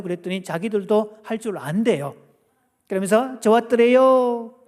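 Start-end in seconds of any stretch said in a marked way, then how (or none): 3.4-4.5 Gangwon dialect. Retold or imitated. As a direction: stretch the last syllable in Gangwon dialect. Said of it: "강원도는 수식어가 없어요".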